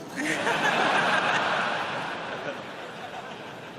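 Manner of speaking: airy unvoiced laugh at the punchline; breathy laugh. Real, not acted. Comedian laughing at his own punchline, breathy laugh.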